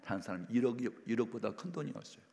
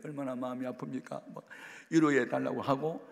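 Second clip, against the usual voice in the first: mock tearful prayer; 4 semitones higher, 6 dB louder than usual. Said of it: "막 울면서 나 기도를 했더니".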